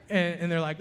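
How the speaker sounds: ordinary talk, nothing marked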